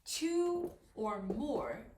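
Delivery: slow, spaced-out key phrase; slow emphasis. Real, not acted. Teacher drilling the rule not to forget, slow and deliberate. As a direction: spell it out slowly for learners.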